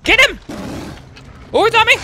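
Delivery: high, loud panicked yells; frantic game shouting. Real, not acted. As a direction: shout it out in a sudden scramble. Attacked during game fight.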